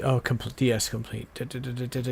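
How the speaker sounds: ordinary talk, nothing marked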